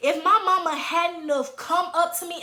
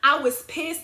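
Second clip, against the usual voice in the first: ordinary delivery, nothing marked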